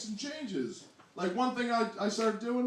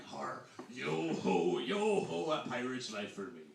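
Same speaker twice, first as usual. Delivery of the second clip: low, slow pirate chant; mock a stock storybook pirate; 7 semitones lower, slower than usual. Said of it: "offended by that, like".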